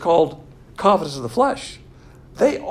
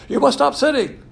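mock preachy believer; 9 semitones higher, 6 dB louder than usual. Mocking new believers' preaching.

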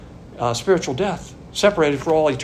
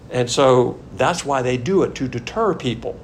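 usual speaking voice

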